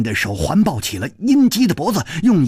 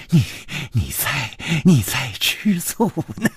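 breathy giggles breaking up the words; amused laughing; 5 dB quieter than usual. A narrator's reading. Woman teases her lover about jealousy, giggling. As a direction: laugh softly through a halting tease. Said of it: "嘴角却泛起了微笑".

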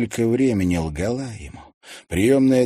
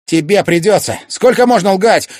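high, very loud demand; angry shout; 13 semitones higher, 13 dB louder than usual. A narrator's reading. Son accusing his mother of lying, shouting. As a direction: shout back in exasperation.

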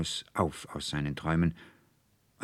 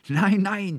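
high, loud cry of denial; shouting; 8 dB louder than usual. A narrator's reading. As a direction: shout it out in protest. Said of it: "schrie der Student Anselmus".

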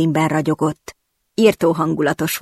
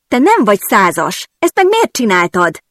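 higher, louder indignant cry; shouted; 5 semitones higher, 5 dB louder than usual. Acted. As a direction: yell it at him, splashed and outraged. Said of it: "kiáltottam rá". (felháborodott kiáltás, magasabban és hangosabban)

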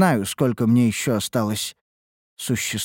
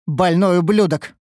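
higher, louder burst of disgust; venomous contempt, pitch raised; spat out; 7 semitones higher, 5 dB louder than usual. A narrator's reading. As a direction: spit the insult out. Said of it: "выплюнула Витеро".

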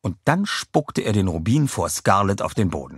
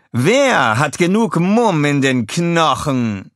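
high, loud, slow villainess challenge; a high, loud, slow villain's dare to the crowd; Scarlett calling out; 8 semitones higher, 6 dB louder, slower than usual. A narrator's reading. Scarlett dares the villains, calling high and loud.

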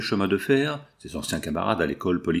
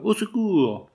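much higher desperate cry; cry for help; 10 semitones higher, slower than usual. A narrator's reading. Jeanne cries for help while the foreman drags her off. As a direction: scream for help as she is dragged away. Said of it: "Jeanne répéta deux fois".

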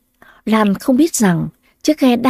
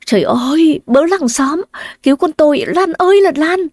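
panicked scream; 7 semitones higher than usual. Acted. Mother screams for help as her daughter drowns, panicked. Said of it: "Bà Tư giật mình la lớn".

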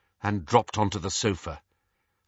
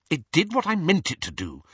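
higher, a touch cross; crossly; 7 semitones higher than usual. A narrator's reading. Hermione defending her spell to Ron, mildly cross. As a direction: bristle a little. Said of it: "said Hermione rather crossly".

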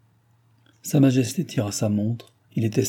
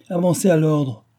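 a lightly voiced king, higher and formal; mock royal command; 6 semitones higher, 4 dB louder than usual. A narrator's reading. King playfully summons the boy governor, mock solemn. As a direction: summon him like a king at a ceremony. King calls Zamore forward.

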